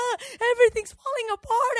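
high, whimpering mock panic; mock anxiety. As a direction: act out falling to pieces, half-crying. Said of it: "I'm getting all this anxiety".

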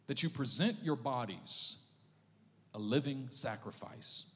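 slow scripture reading. Shown as a demonstration. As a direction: read it slowly for eyes-closed listeners. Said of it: "just close your eyes and listen".

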